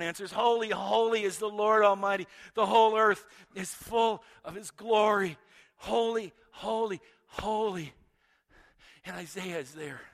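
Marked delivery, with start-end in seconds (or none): 0.3-7.9 louder angelic proclamation, each holy falling away. Acted. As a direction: declare it to the whole temple. Angels' song voiced by preacher.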